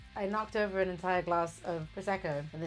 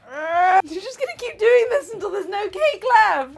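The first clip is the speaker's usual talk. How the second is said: exasperated; 11 semitones higher, 12 dB louder than usual. Real, not acted. Frustrated baking.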